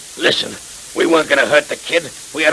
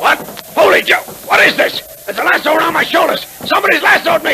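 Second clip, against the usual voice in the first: high, loud alarmed yelling; startled shout; 5 dB louder than usual. Acted. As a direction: caught off guard by a rope. Thug caught by lasso.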